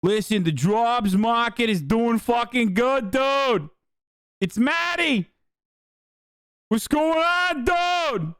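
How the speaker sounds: mock Marty Walsh with a thick Massachusetts drawl; mock Massachusetts accent. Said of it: "thick of a fucking Massachusetts accent".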